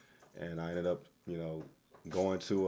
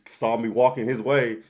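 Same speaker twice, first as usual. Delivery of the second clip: ordinary talk, nothing marked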